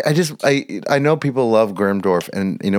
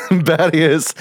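put-on a touch of Battius's drunken British; 6 semitones higher than usual. Player fearing he'll slip into old character, drunken British touch. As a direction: slip into the boozy pirate drawl. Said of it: "my brain is saying".

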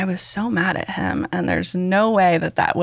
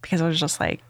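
ordinary talk, nothing marked

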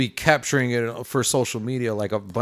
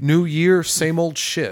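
loud jokey complaint, pitched up; loud groan; 7 semitones higher, 9 dB louder than usual. Real, not acted. Cohost griping loudly about the new year, mock exasperated. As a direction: belt it out as a mock gripe.

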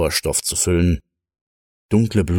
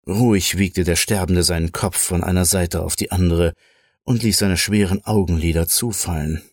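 ordinary delivery, nothing marked

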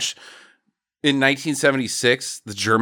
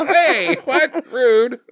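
high, indignant mock surprise; mock offended; 9 semitones higher, much slower than usual. Valet put out after the ladies rebuff him. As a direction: act hurt at the rebuff.

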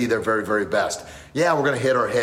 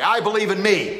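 firm demand; 9 semitones higher than usual. Retold or imitated. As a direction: make him mean it. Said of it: "Look me in the eye".

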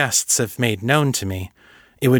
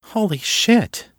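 slow, much higher gasp of disbelief; stunned; 11 semitones higher, slower than usual. Acted. Kate realizes her friends hid telepathy, stunned and slow. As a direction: let the shock stretch the words.

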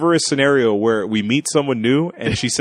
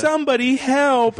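mock cry for help; 12 semitones higher than usual. Girl fakes drowning at camp.

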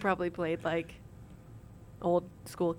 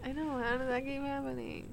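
soft, higher-pitched whine; whiny voice; 5 semitones higher, 5 dB quieter than usual. Real, not acted. Host moaning about another break, whiny. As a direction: complain in a small whiny voice. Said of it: "The whiny voice".

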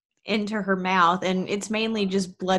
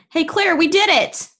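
higher, louder celebratory shout; triumphant call; 9 semitones higher, 5 dB louder than usual. Acted. Becky tells paralyzed Claire the problem is solved, calling out. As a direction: call the good news over to a friend.

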